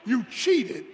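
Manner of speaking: indignant loser accusing his wife; mock accusation. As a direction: blurt out the charge of cheating. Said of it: "what came out of my mouth".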